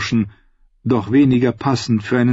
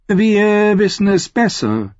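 higher, slow, drawn-out contradiction; knowing interrogator; 9 semitones higher, slower than usual. A narrator's reading. Jailer with razor contradicts the prisoner, knowing.

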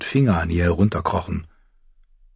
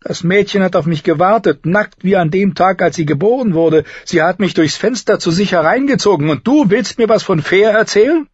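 higher, mocking voice; mocking Darion; 12 semitones higher, 3 dB louder than usual. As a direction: needle him with a sneering grin.

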